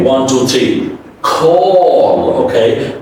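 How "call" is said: "call" long, rounded aw into dark L; model to repeat. Shown as a demonstration. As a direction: draw out "call" for the learner. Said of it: "Repeat after me".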